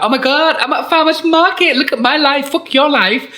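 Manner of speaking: shrill-free high vlogger gush, hammed up; mocking a farmers market video; mock influencer. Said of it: "your little cute video like".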